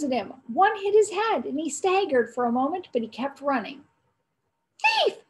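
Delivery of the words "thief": high, loud yell after a thief; shouted. A narrator's reading. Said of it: "the boys shouted".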